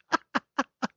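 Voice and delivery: a short high burst of laughter. Real, not acted. Vlogger laughing at the politician's confession, high laugh.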